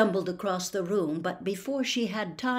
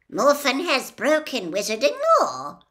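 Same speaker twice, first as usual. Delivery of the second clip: stern, measured statement; sternly; 7 semitones higher, 7 dB louder than usual. A narrator's reading. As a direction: lay down the law, grave and firm. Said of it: "said Ogden sternly".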